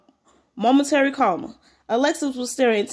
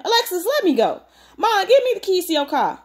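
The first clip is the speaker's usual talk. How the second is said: higher, agitated insistence; angry demand; 8 semitones higher than usual. A narrator's reading. Husband demanding car keys from mother, angry. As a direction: demand it urgently, voice raised in pitch.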